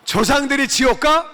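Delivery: father's indignant outburst, pitched high and loud; retold angry shout from a father. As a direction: shoot it back at your son in outrage. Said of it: "우리 아버지가 화가 나셔가지고요".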